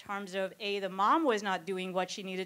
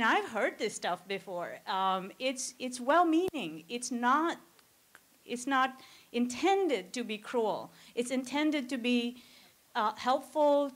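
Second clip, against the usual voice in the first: ordinary talk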